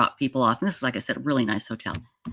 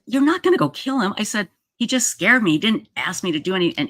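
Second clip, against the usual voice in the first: alarmed pleading; 6 semitones higher than usual. Retold or imitated. Pleading with her furious brother.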